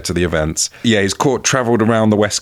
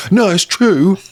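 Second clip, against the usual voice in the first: mock protest; 12 semitones higher than usual. Mock earnest defence after chin gesture.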